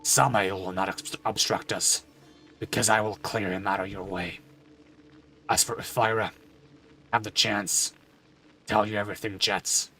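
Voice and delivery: a weary, grieving father; somber father voice. Acted. Father character speaks again.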